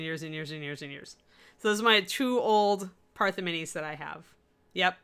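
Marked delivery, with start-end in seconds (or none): none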